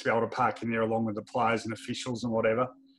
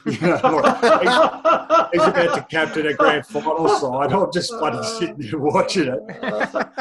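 laughing; 4 semitones higher, 7 dB louder, slower than usual. Real, not acted. Laughing at the absurd memory.

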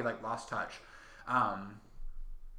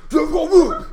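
loud, higher proclamation; emphatic shout; 13 semitones higher, 17 dB louder, slower than usual. Real, not acted. Fan corrects himself to two words, triumphant loud shout. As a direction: belt out the name.

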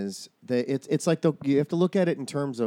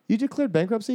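higher, incredulous question; mock surprise; 9 semitones higher than usual. Asking friends about bankruptcy, surprised. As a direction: ask it in disbelief. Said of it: "I'm like".

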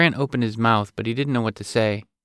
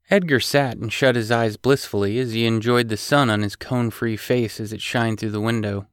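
usual speaking voice

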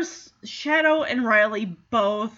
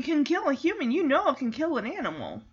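ordinary talk

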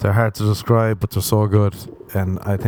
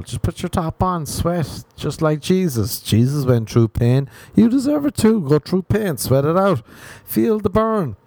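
mock preacher; 7 semitones higher than usual. Comedian preaching to shy Irish men, mock sermon. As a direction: preach suffering like a fired-up zealot.

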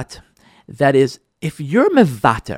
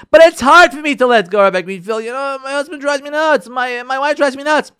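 higher, whiny protest; complaining listener; 9 semitones higher than usual. Acted. Listener complaining to the rabbi about a spouse, whiny and higher. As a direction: plead it's too hard.

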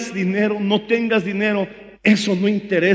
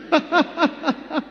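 risa; 9 semitones higher than usual. Real, not acted. Risa tras voz de burra.

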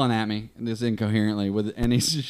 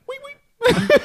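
very high, louder two-note squeak; imitated whistle; 5 dB louder than usual. Imitating patient's whistle at women, piercing squeak. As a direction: mimic a toothless wolf whistle. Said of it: "I used to whistle at 'em".

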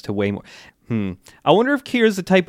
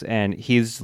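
normal talking voice

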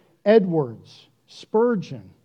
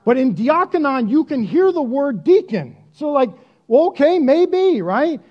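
animated concession; 7 semitones higher, 6 dB louder than usual. Real, not acted. Half-conceding the deacon reading.

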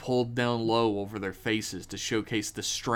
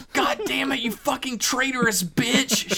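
high, tight furious hiss through teeth; angry hiss; 13 semitones higher, 4 dB louder than usual. A narrator's reading. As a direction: spit it out through clenched teeth. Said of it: "she hissed through her clenched teeth".

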